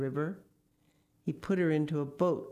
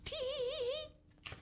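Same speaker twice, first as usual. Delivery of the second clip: soft, very high, drawn-out tee-hee; tiny squeaky giggle, held back; irrepressible giggle; 7 dB quieter, slower than usual. A narrator's reading. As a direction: titter slowly way up high. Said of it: "could not keep from laughing".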